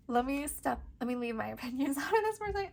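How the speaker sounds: fast, giggling through the words; trying to get a grip but giggles break through; laughing apology. Real, not acted. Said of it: "I can't stop laughing".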